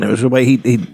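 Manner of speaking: ordinary talk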